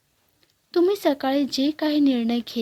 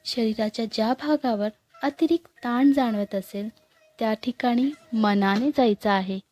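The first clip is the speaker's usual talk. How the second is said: guided meditation. Acted. Guide leading listeners through morning breathing meditation, calm and unhurried. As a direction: guide them gently into stillness, leaving long pauses.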